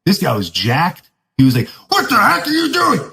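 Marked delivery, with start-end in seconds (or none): 1.9-3.1 much higher imitated yell; mock yell. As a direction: yell it like the jacked officer. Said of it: "at the top of his lungs".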